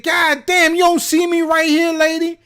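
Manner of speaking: high, loud scolding yell; yelling at a woman who cut the line; angry shout. Retold or imitated. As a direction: call her out hard for cutting. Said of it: "I'm already pissed".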